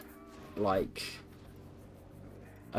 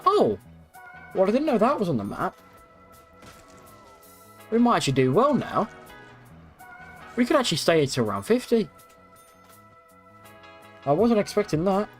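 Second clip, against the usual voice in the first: excited; 9 semitones higher than usual. Real, not acted. Excited surprise at game map.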